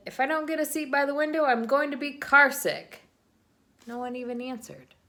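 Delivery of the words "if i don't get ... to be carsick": put-on higher, louder, fast child's complaint; whiny demand. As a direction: whine it like a squished kid in the back seat. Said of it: "I said".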